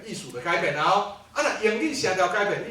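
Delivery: ordinary delivery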